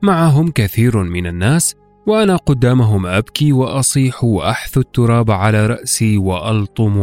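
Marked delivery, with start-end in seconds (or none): none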